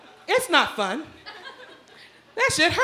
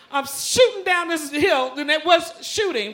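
ordinary talk

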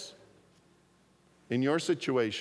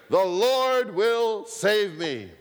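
slow, loud shout over the helicopter noise; 5 semitones higher, 4 dB louder, slower than usual. Acted. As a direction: call it out slowly and loudly. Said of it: "he just shouted".

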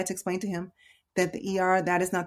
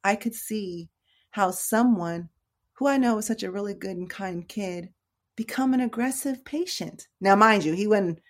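ordinary delivery

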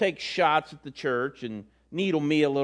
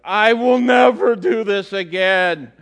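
put-on slow, louder worn-out kid vowing never again; exhausted daughter; 6 semitones higher, 9 dB louder, slower than usual. Daughter after her first race, slow and loud. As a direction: drag it out like a spent runner.

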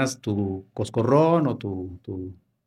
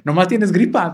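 madre exasperada; 5 semitones higher, 5 dB louder than usual. Retold or imitated. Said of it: "te decía tu mamá".

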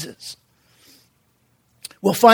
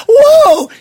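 high, loud burst of shock; mock startled cry; startled whoa; 6 dB louder than usual. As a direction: jolt as if hit by a wave. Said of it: "you're like".

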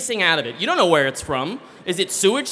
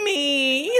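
much higher timid Me; sheepish; 10 semitones higher than usual. Retold or imitated. Woman curled in corner answering the attendant, sheepish. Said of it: "very sheepishly".

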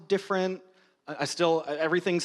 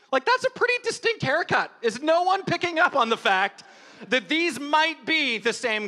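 incredulous rant; 7 semitones higher than usual. Real, not acted. Mocking He-Man's missing disguise.

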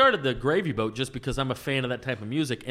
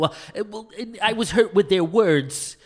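higher, pitiful complainant voice; Saul; 5 semitones higher than usual. Retold or imitated. Caller telling HR about hurt feelings, pitiful. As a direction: imitate a wounded, whiny prank caller. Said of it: "One time Saul called".

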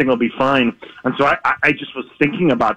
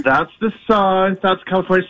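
imitating a realization; 6 semitones higher than usual. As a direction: draw it out like it's just dawning on you. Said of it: "then you start to figure out".

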